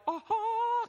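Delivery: high stunned "aha"; deer-in-headlights gasp. Acted. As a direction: freeze in high-pitched astonishment. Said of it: "like a deer in the headlight".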